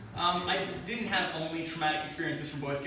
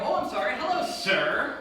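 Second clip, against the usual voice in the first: higher, mock-formal apology; mock apologetic; 7 semitones higher, 5 dB louder than usual. Daycare worker placating a child, mock-formal and higher. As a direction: humor the kid with fake respect. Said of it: "And I go".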